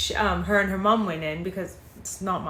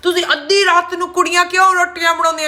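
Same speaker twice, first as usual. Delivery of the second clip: high, loud, fast mock scolding; imitated scolding mother; 9 semitones higher, 7 dB louder, faster than usual. Mother asking why girls make rotiyan at midnight, imitated scolding. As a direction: rattle it off as an indignant auntie's telling-off.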